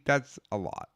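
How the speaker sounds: ordinary talk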